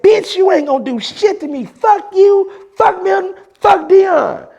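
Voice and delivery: mimicking JR. JR cursing the speaker out on the phone, high mocking voice. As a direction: squeal it like an angry rival. JR's voice, heated phone threat.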